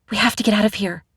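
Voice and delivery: 1 panicked urgency. A narrator's reading. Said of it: "my voice rushed with panic".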